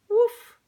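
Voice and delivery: high, loud woof; dog woof. A narrator's reading. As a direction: bark like a dog. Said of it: "said the creature".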